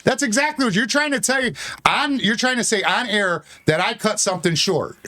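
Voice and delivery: high-pitched angry protest; real heated accusation; getting loud. Real, not acted. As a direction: shout it back indignantly. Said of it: "Why are you getting so loud?".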